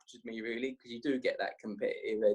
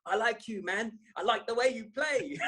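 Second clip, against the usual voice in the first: higher, laughing imitation of a mate's accent; Abbas B's accent; 9 semitones higher than usual. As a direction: mimic a teammate's accent, giggling through it. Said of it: "I got his accent in my head when I say it".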